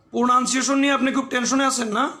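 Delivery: usual speaking voice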